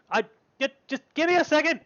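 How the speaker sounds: high, flustered little voice; high-pitched girl putting them off; girl in wrong accent. Acted. Girl answers the call for the cauldron, high flustered reply. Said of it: "that was the wrong accent".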